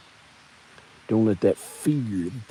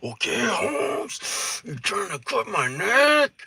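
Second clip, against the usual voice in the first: much higher, slow disbelieving reply; high mock protest; high incredulous; 11 semitones higher, 4 dB louder, slower than usual. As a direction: sound shocked at the knife. Captive answering knife threat.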